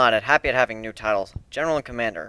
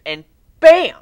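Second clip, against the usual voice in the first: high loud burst; excited bam; 13 semitones higher, 14 dB louder than usual. Acted. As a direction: punch it out. Excited exclamation.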